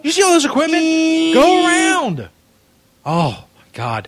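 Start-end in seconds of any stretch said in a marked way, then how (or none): 0.0-2.3 high, loud shouts across a parking lot; yelling at drivers. Acted. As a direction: wave the cars off, furious.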